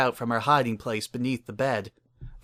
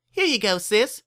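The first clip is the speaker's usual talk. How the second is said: put-on much higher, bright little-girl voice; eager little filly; 11 semitones higher, 4 dB louder than usual. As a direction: hand it over like a proud little sister. Filly hands over towel.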